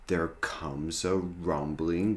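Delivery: ordinary delivery, nothing marked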